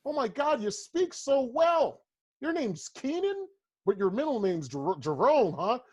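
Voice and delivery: slow, high, gushing mock voice. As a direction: drag it out sweet and high like a condescending fan.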